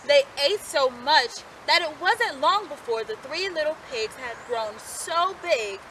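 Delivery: ordinary delivery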